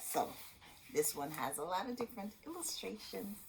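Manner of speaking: ordinary delivery, nothing marked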